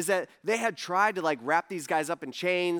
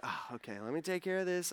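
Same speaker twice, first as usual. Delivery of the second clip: tired Jesus; 9 dB quieter than usual. Retold or imitated. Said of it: "He's like".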